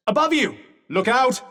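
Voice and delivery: urgent warning. Acted. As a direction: call out a sudden danger. Combat warning to Frey.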